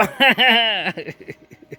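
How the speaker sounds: helpless giggle at the stubborn table; high burst of laughter. Real, not acted. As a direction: crack up at the table. Table won't stay up.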